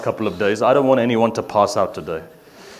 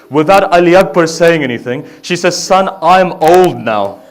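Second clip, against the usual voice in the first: full-voiced grieving chant, louder and higher; loud lament recitation; 10 semitones higher, 12 dB louder than usual. Reciter mourning Ali Akbar's farewell to the gathering, loud wailing lament. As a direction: cry the story out to the mourners.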